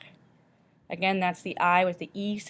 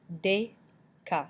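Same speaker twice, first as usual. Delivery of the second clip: "Deca" split in two, pause after "De"; syllable by syllable. Shown as a demonstration. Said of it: "makes the A sound".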